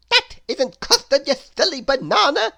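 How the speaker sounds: high, loud exasperated voice; Mister Silly crying out. A narrator's reading. Mister Silly correcting his friend, high loud cry. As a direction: correct him with a laugh in it. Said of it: "cried Mister Silly".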